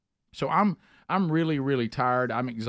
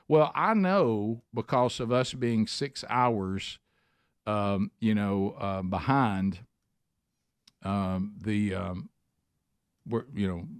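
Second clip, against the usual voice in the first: ordinary delivery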